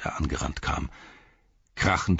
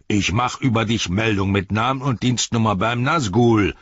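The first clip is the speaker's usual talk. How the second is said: higher-pitched angry threat; threatening orc soldier; 6 semitones higher, 7 dB louder than usual. Acted. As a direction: threaten him as an indignant orc. Soldier threatens tracker in quarrel.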